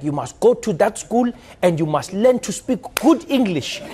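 put-on slow, commanding African-accented parent voice; strict parent. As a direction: lecture the child like a strict South African parent. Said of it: "your parents would be like".

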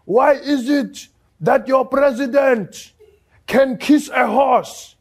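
loud, high-pitched old grandfather impression; hammed-up, drawn-out plea to the master; grandfather addressing policeman. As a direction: slowly call out in a put-on elder's voice. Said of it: "my grandfather turns to the cop".

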